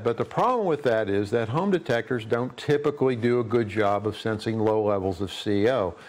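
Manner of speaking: ordinary talk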